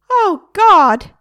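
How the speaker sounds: high, loud horrified parrot cry; a parrot told it is stuck for life, very high and loud; shocked cry. A narrator's reading. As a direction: cry out as if about to faint. Parrot shocked before fainting.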